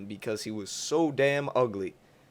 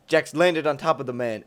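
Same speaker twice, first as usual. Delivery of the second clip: ordinary delivery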